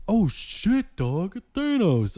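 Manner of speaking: high, slow mock shout of a stunned guy; excited moviegoer. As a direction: imitate a dude blurting out a spoiler. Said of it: "he was like".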